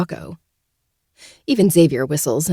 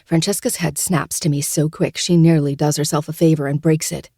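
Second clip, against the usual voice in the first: usual speaking voice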